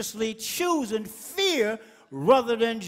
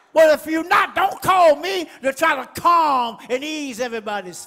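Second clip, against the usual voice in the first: loud, rising sermon cry; full-force preaching; 2 semitones higher, 8 dB louder than usual. Acted. Preacher stirring the congregation, loud and fierce. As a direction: preach it hard to the crowd.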